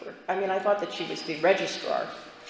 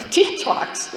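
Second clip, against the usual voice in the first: incredulous; 12 semitones higher, 3 dB louder than usual. Retold or imitated. Offended disbelief at the suggestion.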